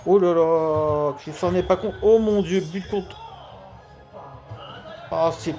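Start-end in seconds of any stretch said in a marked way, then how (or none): 0.0-2.6 surprised. Acted. Commentator reacts to an own goal.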